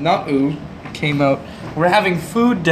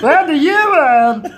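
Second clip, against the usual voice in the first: put-on much higher, louder, slow French accent; 11 semitones higher, 6 dB louder, slower than usual. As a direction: belt it in a hammy French accent. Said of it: "You should do that accent properly".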